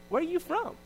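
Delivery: high, curious asking; curious question. Pretend. Onlookers asking a believer, high and curious. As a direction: lift it like real wonder.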